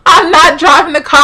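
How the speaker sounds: loud, high-pitched whine of disbelief; indignant protest. Retold or imitated. Protesting his blame, pitched up.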